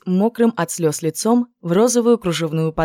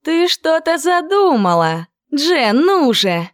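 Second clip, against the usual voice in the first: much higher, slow, pleading; eager urging; 10 semitones higher, 4 dB louder, slower than usual. Acted. Clover urging Jen to share the plan, eager. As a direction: coax it out eagerly.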